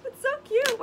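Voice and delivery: squealy delight; excited gushing. Real, not acted. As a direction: gush like you can't contain it. Gushes over the craft.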